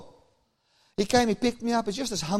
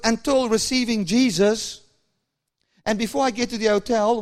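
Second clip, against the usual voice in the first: usual speaking voice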